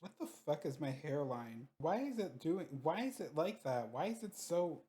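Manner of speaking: very soft, high-pitched questioning; hushed bafflement. Real, not acted. Player baffled by own hairline, hushed bafflement. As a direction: puzzle to yourself under your voice, pitch rising.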